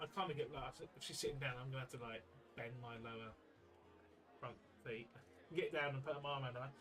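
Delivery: usual speaking voice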